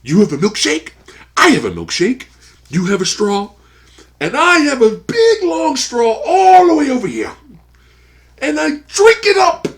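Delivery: loud, high theatrical speech building to a shout; over-the-top film monologue impression; hammed-up taunting villain. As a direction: taunt with mock grandeur, escalating to the last words. Impersonating Daniel's milkshake speech.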